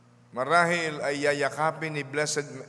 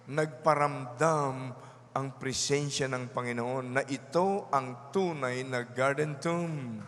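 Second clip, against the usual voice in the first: normal talking voice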